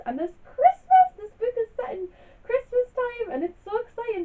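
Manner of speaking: real burst of Christmas excitement, high and loud; excited. Real, not acted. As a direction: squeal it for the love of Christmas. Gushing about a Christmas book.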